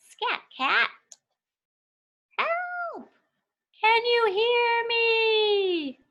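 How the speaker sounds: very high, slow, wailing little voice; tiny character calling out for help; tiny desperate cry. Acted.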